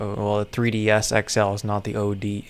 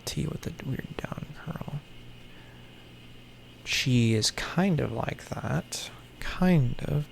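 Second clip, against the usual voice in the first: whisper; 8 dB quieter than usual. Real, not acted. Whispering while comparing characters.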